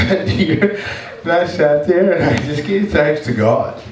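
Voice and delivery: real emotion lifting the voice high; choked up. Real, not acted. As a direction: give thanks while close to tears. Moved testimony after retold weeping.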